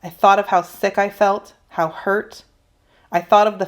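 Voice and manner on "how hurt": usual speaking voice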